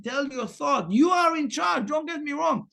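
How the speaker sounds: ordinary delivery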